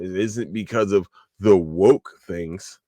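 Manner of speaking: ordinary delivery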